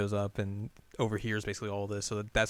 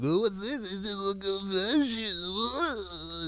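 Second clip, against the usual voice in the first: high, drawn-out taunt; mock teasing; 10 semitones higher, much slower than usual. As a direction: tease with long stretched vowels up high. Two dudes tease her about the letter.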